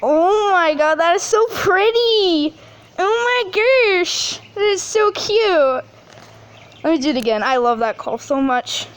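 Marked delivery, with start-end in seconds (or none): 0.0-5.8 high, slow, delighted cooing over the sound; excited gushing. Real, not acted. Vlogger hears the bird call, high slow gushing. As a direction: melt over how pretty it is.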